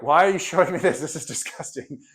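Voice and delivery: recoiling at the picture, voice pushed away from normal; disgusted student. Pretend. Student shown cannibal picture, disgusted reaction. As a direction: act grossed out by the slide. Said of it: "You're like".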